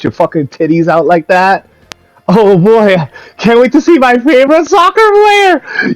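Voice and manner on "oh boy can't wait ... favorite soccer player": high, loud giddy mock voice; hammed-up squeaky excitement; mocking eager fan. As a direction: gush like an overexcited old fan.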